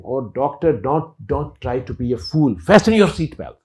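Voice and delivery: loud panicked yell, pitch jumping up; shouting. Retold or imitated. Atheist yelling at the laughing doctor, alarmed shout. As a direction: bark the order in alarm. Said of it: "he started shouting".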